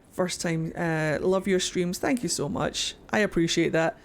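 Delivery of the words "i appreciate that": usual speaking voice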